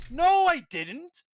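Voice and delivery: high, loud, drawn-out protest; indignant denial. Retold or imitated. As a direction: push back in stretched-out disbelief. Retold denial of customer complaint.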